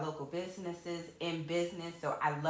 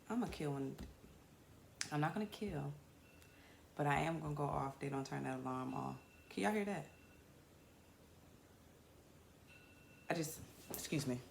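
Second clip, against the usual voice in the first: quiet, lower irritated aside; muttered annoyance; 4 semitones lower, 10 dB quieter than usual. Real, not acted. Host grumbles off-mic about the alarm, quiet and low. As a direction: grumble it under your breath.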